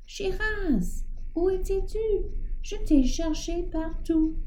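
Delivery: cri aigu de soulagement, bien plus haut; le dompteur appelle son éléphant à pleine voix. Acted. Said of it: "interrompu par un cri". (appel aigu et criard)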